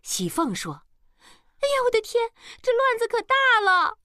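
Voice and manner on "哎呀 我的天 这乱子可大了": high, loud wail of dismay; alarmed maid. A narrator's reading. Maid panics as her mistress drives off, alarmed wail.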